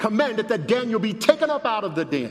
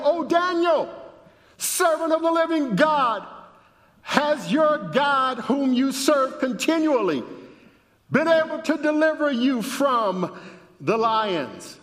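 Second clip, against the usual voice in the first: a king's anguished call, read aloud; anguished cry; 9 semitones higher than usual. A narrator's reading. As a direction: cry out in anguish, pitched well up. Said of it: "cried out in a tone of anguish".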